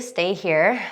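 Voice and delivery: usual speaking voice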